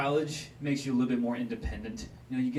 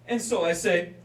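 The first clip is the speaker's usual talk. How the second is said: ordinary talk, nothing marked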